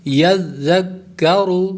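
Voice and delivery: یذکرو recited slowly, ya joined to zal and zal to the doubled kaf; tajweed recitation. Shown as a demonstration. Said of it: "را پر ہوگا".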